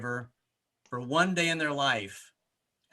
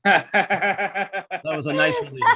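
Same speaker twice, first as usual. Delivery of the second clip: laughing; 4 semitones higher, 5 dB louder, much slower than usual. Real, not acted. Laughing punchline aside.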